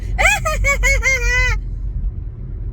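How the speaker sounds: very high, drawn-out mock cackle; Joker-like laugh. Mimicking the caller's uncomfortable laughing, manic Joker cackle. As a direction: imitate a manic laugh that won't stop. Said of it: "Sound like the damn Joker".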